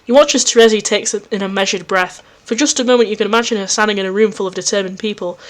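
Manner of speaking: ordinary talk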